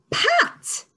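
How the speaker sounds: thrilled high exclamation; excited reveal. Shown as a demonstration. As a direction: burst out the word with delight. Teacher reveals word excitedly.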